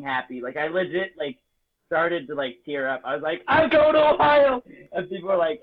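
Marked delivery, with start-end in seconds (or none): 3.5-4.6 high, loud cry of joy; retold shout of pure delight; overjoyed shout. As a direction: yell it like the best news ever. Overjoyed at the mission call.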